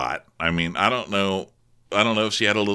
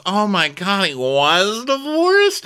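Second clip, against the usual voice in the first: high, drawn-out mock shock; Marisol; 10 semitones higher, 4 dB louder, much slower than usual. Marisol reacts to news of the divorce, hammed-up shock. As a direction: stretch it out, climbing high on the last word. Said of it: "And Marisol goes".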